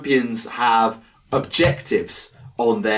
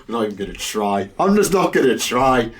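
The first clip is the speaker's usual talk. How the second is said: ordinary talk, nothing marked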